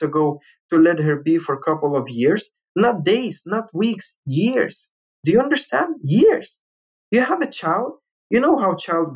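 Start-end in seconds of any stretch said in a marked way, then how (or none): 2.8-6.6 retold angry lecture to the lawyer; angry emphasis. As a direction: hammer each word, much higher. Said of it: "really angry".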